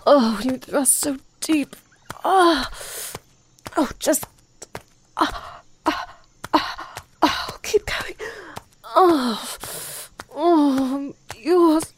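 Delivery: breathless moans building up, voice cracking; moaning. Acted. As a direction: let the moans climb, gasping between words.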